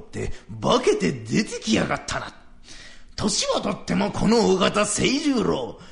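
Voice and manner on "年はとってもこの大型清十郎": louder, higher heroic declaiming; warrior bravado. Retold or imitated. Old man acts out charging with his spear. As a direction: puff up like a spear-wielding samurai.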